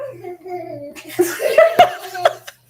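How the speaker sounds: amused mom's laugh; real laugh. Real, not acted. Laughing at daughter.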